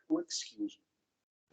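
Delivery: "excuse me" said very softly, almost whispered; soft demo. Shown as a demonstration. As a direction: model a hushed "excuse me". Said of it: "O que que eu faço primeiro?".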